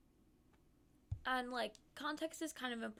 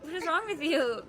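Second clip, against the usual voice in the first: mock exasperated; 5 semitones higher, 9 dB louder than usual. Retold exasperated reply.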